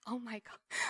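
breathless whispered gasp of relief; awed whisper. Retold or imitated. Mother hearing her son survived, awed whisper. Stunned relief at lab results.

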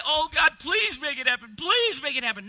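high, hammed-up begging prayer; mock pleading. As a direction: beg God desperately, as a parody. Mimics a begging prayer, then rejects it.

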